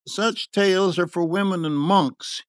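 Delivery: impatient. A narrator's reading. First speaker dismissing the miracle tale, mildly impatient. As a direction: wave it aside with a touch of impatience. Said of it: "he said impatiently".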